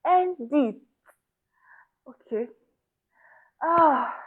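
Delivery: gasping for air between the words; out of breath. Real, not acted. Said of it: "let me, let me catch my breath".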